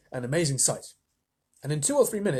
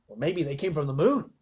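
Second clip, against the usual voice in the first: plain reading voice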